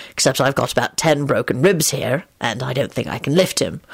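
low-voiced, short explanation; short reply. A narrator's reading. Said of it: "he replied shortly".

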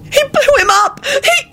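very high, crying outburst; hysterical yell. A narrator's reading. Elizabeth to Lupin about the empty coffin, hysterical. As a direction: shriek it in hysterical tears. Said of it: "Elizabeth yelled hysterically".

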